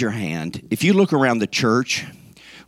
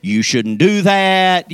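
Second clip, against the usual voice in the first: slow, higher, mock scolding; hammed-up slow scolding of the keys; mock angry at keys; 6 semitones higher, much slower than usual. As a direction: scold the keys like a naughty child. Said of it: "We get mad".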